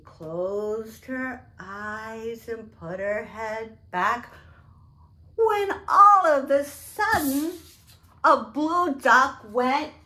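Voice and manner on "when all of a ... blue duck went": louder, rising to a loud quack; reading aloud to children, building suspense; storybook build-up. A narrator's reading.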